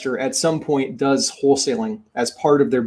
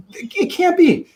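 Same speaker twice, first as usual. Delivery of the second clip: disbelief; 14 semitones higher than usual. Retold or imitated. Nate disbelieving it's illegal.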